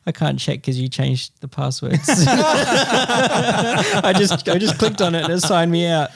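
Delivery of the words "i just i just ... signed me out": laughing. Real, not acted. Laughing at being signed out.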